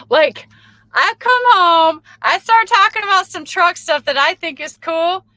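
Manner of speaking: put-on telling viewers how she gushes to her kids; gushing enthusiasm. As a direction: show how excited she gets about trucks. Acting her own truck enthusiasm.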